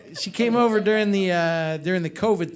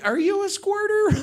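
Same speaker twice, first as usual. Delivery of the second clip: startled question; 13 semitones higher than usual. Retold or imitated. Awkward question during lap dance.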